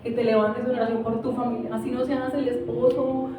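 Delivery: soft, earnest exhortation over music; preaching over music. Acted. A quiet pastor pleading with the men to rise in prayer. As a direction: pastor urging the men to pray.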